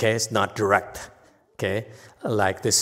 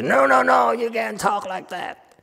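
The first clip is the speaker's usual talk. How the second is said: raised-voice mock scolding; offended objector; 10 semitones higher than usual. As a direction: imitate an indignant English specialist objecting. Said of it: "We get offended when you use".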